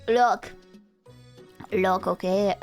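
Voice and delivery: usual speaking voice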